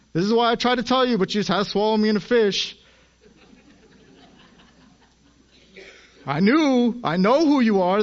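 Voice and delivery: high, loud whiny complaint; pouting childish Jonah. Acted. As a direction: whine at God like a sulking child. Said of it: "as he's pouting and crying like a child".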